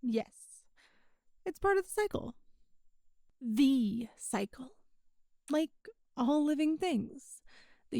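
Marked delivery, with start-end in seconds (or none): 3.4-4.7 "The" drawn out slowly as "thee"; stress on The. Acted. Demon explaining the universe's cycle to a human, "The" stretched. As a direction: lean long and slow on "The".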